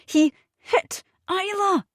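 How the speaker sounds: high, slow, shaken cry; distraught accusation. A narrator's reading. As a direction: let it break out in horror. Maggie accuses Nick, distraught.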